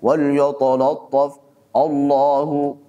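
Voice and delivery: yatalattaf with dull, rounded o vowels; lazy heavy reading. Shown as a demonstration. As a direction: Allahu said thick and lazy, like otolatof. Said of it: "malas-malasan sehingga jadi tebal".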